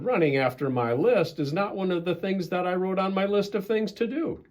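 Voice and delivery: high whiny wail. Acted. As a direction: keep wailing in despair. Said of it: "wailed Toad".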